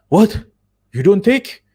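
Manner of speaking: high, loud disbelieving "What?"; shocked owner. Retold or imitated. Restaurant owner stunned the driver never ate, loud high surprise.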